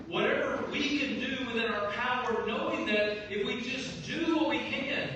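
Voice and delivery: loud, high, fervent sermon delivery; full-force preaching. Acted. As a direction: drive the point home to the congregation.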